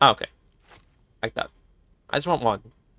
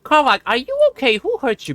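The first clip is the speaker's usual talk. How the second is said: mock concern; 10 semitones higher, 4 dB louder than usual. Teasing companion holding weapon.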